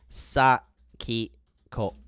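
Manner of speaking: SAKIKO very slowly, split Sa, ki, ko; syllable by syllable. Shown as a demonstration. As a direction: spell out Sakiko by sound, pausing between syllables. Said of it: "Her name is".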